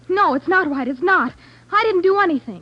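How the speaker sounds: high, loud protest; heated denial. Acted. Girl denies the detective's charge, heated and loud. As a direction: deny it hard, almost shouting.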